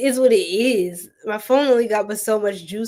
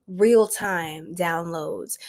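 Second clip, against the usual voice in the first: drawn-out, lower intuitive pronouncement; slow reading voice; 4 semitones lower, much slower than usual. Real, not acted. Psychic slowly announcing her intuitive downloads. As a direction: let each word land slowly.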